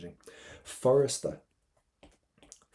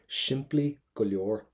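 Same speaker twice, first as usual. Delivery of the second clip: slow model phrase; 4 dB louder, slower than usual. Shown as a demonstration. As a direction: Simply go leor said slowly and clearly. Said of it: "Now listen to this".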